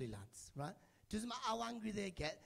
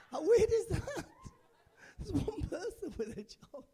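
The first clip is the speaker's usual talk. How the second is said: high, laughing, slower finish; 12 semitones higher, 10 dB louder, slower than usual. Real, not acted. Comedian laughing at the crowd, high and giggling. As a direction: laugh through it, pitched up.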